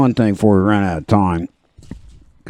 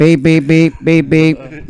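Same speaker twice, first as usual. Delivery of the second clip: higher, bouncy bleeping; mock censor bleep; 6 semitones higher, slower than usual. Host bleeping out a rude joke on air, playful. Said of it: "This is a family show".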